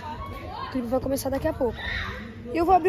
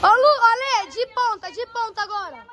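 yelling from the sidelines; 6 semitones higher than usual. Real, not acted. Cheering divers at pool.

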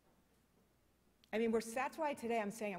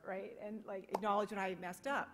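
ordinary delivery

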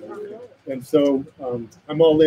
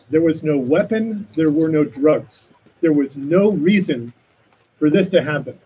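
ordinary delivery

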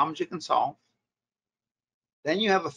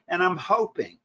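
"hoping" lifted higher and leaned on; stress on hoping; 4 semitones higher than usual. Shown as a demonstration. Coach demonstrates the cold-call opener, stressing "hoping". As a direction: put the emphasis on "hoping". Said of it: "emphasis on the word hope".

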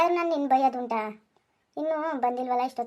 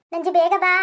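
higher and louder desperate call; yelling for help; 5 semitones higher, 9 dB louder than usual. Acted. Fallen husband shouting for his wife to come, desperate yell. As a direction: shout across the yard to her. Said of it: "ಯಾಕ್ರಿ ಹಂಗ್ ಕೂಗ್ಕೋತಾ ಇದ್ದೀರಾ?".

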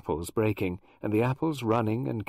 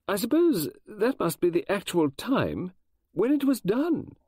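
high, slow and unsteady, losing nerve; faltering voice; 11 semitones higher, 3 dB louder than usual. Acted. Mrs Oliver nearing the murder, voice faltering. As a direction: let the words waver and slow. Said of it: "her voice faltered".

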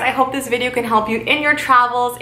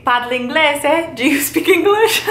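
laughing; 5 semitones higher than usual. Pretend. Tourist asking a local for English, laughing. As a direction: break into laughter as you ask. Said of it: "you can say".

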